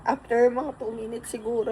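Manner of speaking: ordinary delivery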